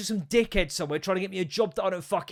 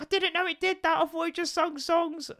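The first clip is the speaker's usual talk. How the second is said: very high, squeaky put-on voice; caricature of wife; 13 semitones higher than usual. Mocking wife's excuse for buying the crying toy, high caricature. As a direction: put on an exaggerated high-pitched voice pleading innocence. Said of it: "That's just my impression of her".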